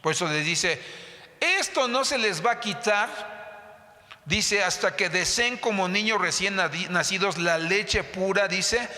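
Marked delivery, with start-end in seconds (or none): 1.4-8.9 raised, emphatic preacher's recitation; scripture reading. Acted. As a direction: proclaim the verse high, prompting the congregation. Reads scripture aloud to congregation. (lectura bíblica proclamada, en tono alto)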